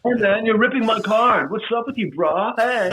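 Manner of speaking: Spicoli impression. Actor quoting the movie for the host, stoner surfer voice. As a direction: play the burned-out surfer kid. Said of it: "Mitch Spicoli is a character".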